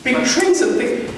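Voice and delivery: ordinary talk, nothing marked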